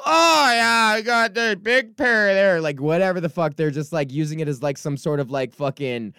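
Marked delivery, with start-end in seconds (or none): 0.0-2.6 put-on much higher, louder, slow drunk blurting; drunk heckler. Comic imitating a drunk heckler answering his joke, loud and slow. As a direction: ham up a sloshed guy shouting back at the stage. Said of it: "And just like".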